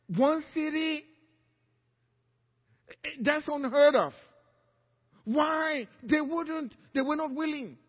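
high, loud, insistent proclamation; full-force preaching. Real, not acted. Preacher asking the congregation why God could not protect the city, impassioned preaching. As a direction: drive each phrase out with pauses between.

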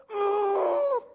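high, loud imitated moan of a sick man; mock groan. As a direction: ham up a roommate's pitiful groan. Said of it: "he goes".

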